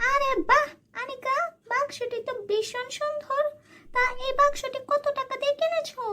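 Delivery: high-pitched sweet-talking aunt; high, sly aunt welcoming her niece. Acted. Aunt eyes the box.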